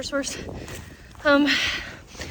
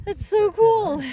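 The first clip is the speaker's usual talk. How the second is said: awed; 8 semitones higher, 6 dB louder, much slower than usual. Real, not acted. Awed by mountain view.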